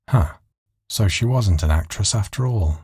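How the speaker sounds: plain reading voice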